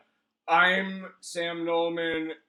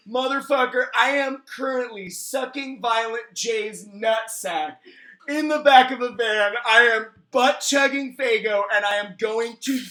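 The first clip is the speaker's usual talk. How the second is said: hammed-up fan rant; 5 semitones higher, 6 dB louder than usual.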